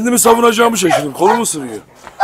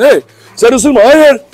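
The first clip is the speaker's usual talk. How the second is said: loud, raised commands to a dog; urgent commands; 4 semitones higher, 5 dB louder, slower than usual. Real, not acted. Trainer to biting Tyson, urgent loud commands.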